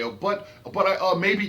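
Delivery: high, loud stammered objection; mock protesting. Objectors making excuses to admins, mock protesting.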